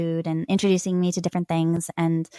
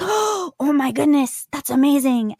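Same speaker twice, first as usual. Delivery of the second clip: higher, slow, gushing amazement; amazed onlookers; 7 semitones higher, 4 dB louder, slower than usual. Retold or imitated. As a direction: gasp and gush like a delighted stranger. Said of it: "the reactions are just always like".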